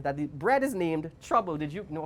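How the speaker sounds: ordinary delivery, nothing marked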